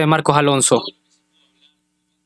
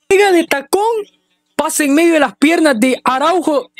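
excited replay commentary; 9 semitones higher than usual. Real, not acted. Replaying goal, still elated.

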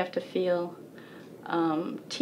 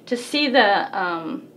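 ordinary delivery

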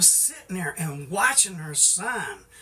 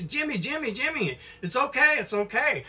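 imitating a mother calling out, higher; mock hollering of his mother, pitched up; mother hollering name; 8 semitones higher than usual. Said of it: "my mother was hollering".

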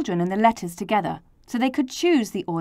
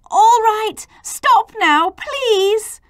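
high, loud plea for order; urgent appeal; 13 semitones higher, 8 dB louder, slower than usual. Acted. A halting the quarrelling letters, urgent raised voice. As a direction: call out over the quarrel.

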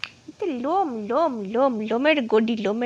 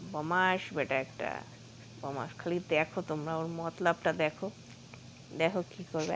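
soft and slow, lower, watching quietly; hushed; 6 semitones lower, 6 dB quieter, slower than usual. Real, not acted. Owner quietly narrating the pet sneaking up to viewers. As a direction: narrate softly so the pet isn't startled.

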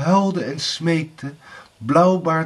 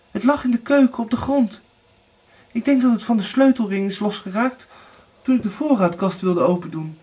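high, hurried explanation; high-voiced wife's excuse; 9 semitones higher, faster than usual. A narrator's reading. Erika explains the lost key to Blauwbaard, high and quick.

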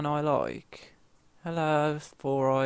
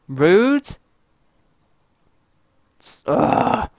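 loud indignant rude; 8 semitones higher, 8 dB louder, much slower than usual. Real, not acted. Complains after being killed.

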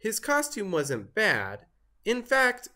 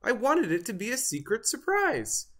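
disappointed turkey; 8 semitones higher than usual. A narrator's reading. Foiled turkey complains.

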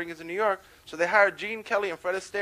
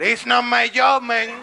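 loud, drawn-out refusal; higher, louder landlord character voice; dismissive landlord; 4 semitones higher, 7 dB louder, much slower than usual. Acted. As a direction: refuse the tenant, slow and loud.

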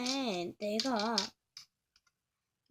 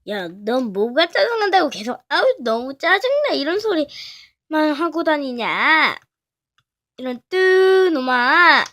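angry scolding; 3 semitones higher, 14 dB louder than usual. Acted. A friend yelling at the one who keeps whining, angry shout. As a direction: tell them off at full volume.

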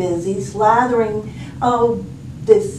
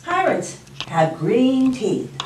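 usual speaking voice

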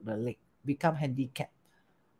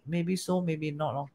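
calm wise man; 4 semitones lower, 10 dB quieter than usual. Retold or imitated. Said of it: "The wise man said".

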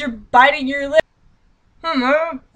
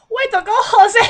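mock Spanish accent; 12 semitones higher, 4 dB louder than usual. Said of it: "saying it in a terrible, probably racist accent".

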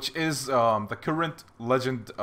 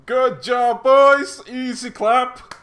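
victory shout; 11 semitones higher, 11 dB louder, much slower than usual. Real, not acted. Clan wins the fight.

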